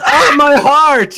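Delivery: loud, high, drawn-out mock cry; hammed-up heart attack; satyr feigning collapse. Satyr faking a heart attack before falling, hammed-up cry. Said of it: "And then he goes".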